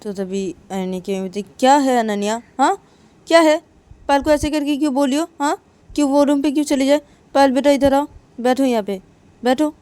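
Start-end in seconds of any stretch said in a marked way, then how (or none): 1.6-9.7 higher, louder scold of a mother. Acted. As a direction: tell off the daughter sharply. Mother scolds Ananya, calls Payal over. (माँ की ऊँची डाँट)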